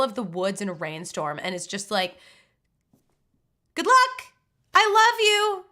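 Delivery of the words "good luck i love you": put-on high, loud send-off in the old woman's role; mock cheerful farewell. Old woman abandoning her fox in a rainstorm, mock high farewell.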